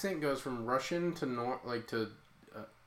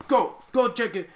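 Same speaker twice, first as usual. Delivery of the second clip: loud, high mock order to a dog; retold urgent command; 9 semitones higher, 8 dB louder than usual. Man sends his dog at the blob, urgent command. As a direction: call it out like sending a dog off.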